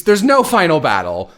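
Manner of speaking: ordinary talk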